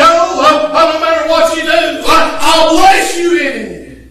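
fiery preaching. Acted. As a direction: preach it to the congregation, half-singing. Preaching at full force.

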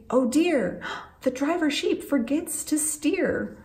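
ordinary talk

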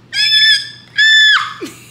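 very high, loud shrieking laugh; real giddy scream-laugh. Real, not acted. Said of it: "I'm done screaming".

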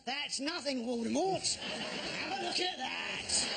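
slow, laughing boast, drawn out at the end; Harry Potter boasting. Retold or imitated. Harry Potter mocking Voldemort in the graveyard. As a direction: show off to Voldemort, savouring it. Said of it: "Harry Potter goes".

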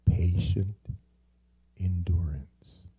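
hushed whisper of the key phrase; "endurance" breathed as a soft whisper. Shown as a demonstration. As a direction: lean in and breathe it.

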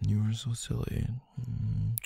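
high, hushed affectionate teasing; soft fond coo. Acted. Partner soothes silly loved one, soft fond coo. Same tease, softened to a coo.

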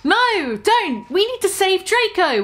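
high urgent plea, lightly acted; begging. A narrator's reading. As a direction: beg him not to leave. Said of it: "we begged".

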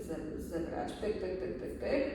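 normal talking voice

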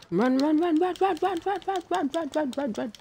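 high, urgent chant of run; panicked high chanting while fleeing; being chased. Real, not acted.

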